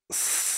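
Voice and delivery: sharp hiss through teeth from pain. A narrator's reading. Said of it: "засипел я от боли".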